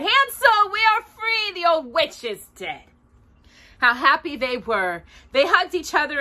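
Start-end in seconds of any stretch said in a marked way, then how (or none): 0.0-2.8 high, bright call of relief; joyful cry. Acted. Gretel freeing Hansel, joyful cry. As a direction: cry out the good news. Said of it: "and cried".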